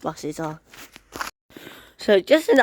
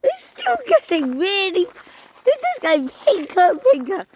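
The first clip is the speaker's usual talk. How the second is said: excited; 10 semitones higher than usual. Real, not acted. Gushing over bus in sunset.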